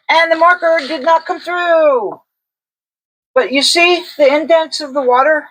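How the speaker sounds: delighted report of a good result; excited. Real, not acted. Pleased discovery while testing paper.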